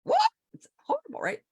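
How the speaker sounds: high squeaky mock horror at the loss; a hammed-up yelp of alarm; mock panic. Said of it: "a lot of people would like".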